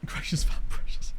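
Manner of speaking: soft unvoiced whisper; hushed whisper. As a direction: breathe it like a secret. Whispering name joke.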